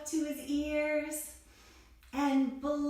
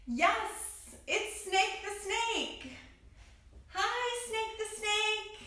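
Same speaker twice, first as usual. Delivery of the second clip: excited greeting; 10 semitones higher, slower than usual. Acted. Telling a child the story, greeting the bee excitedly. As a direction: cheer hello like a delighted child.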